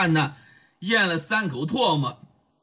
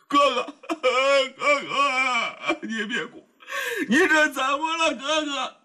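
high, tearful pleading broken by sobs; weeping plea; 7 semitones higher than usual. Acted. A kneeling sworn brother begging his older brother not to cry, tearful. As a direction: beg him to stop crying while crying yourself.

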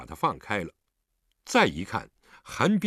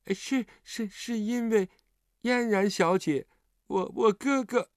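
put-on high, stuttering child voice; nervous repeats, pitched up high; frightened stammer; 9 semitones higher than usual. A narrator's reading. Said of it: "结结巴巴地说".

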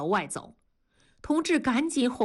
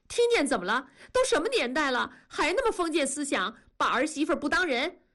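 high, fast, indignant comeback; indignant retort; 7 semitones higher, faster than usual. A narrator's reading. Wife snapping back at her husband about his feudal family, indignant. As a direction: fire it back at him, offended.

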